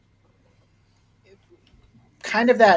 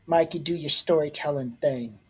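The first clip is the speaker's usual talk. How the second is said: ordinary talk, nothing marked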